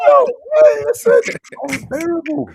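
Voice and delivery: loud laugh breaking through the words; can barely speak for laughing; laughing hard. Real, not acted. Bursts out laughing at friends.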